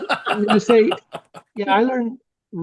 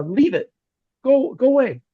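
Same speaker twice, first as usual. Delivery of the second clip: abrupt quote; 5 semitones higher than usual. Retold or imitated. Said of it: "they just say".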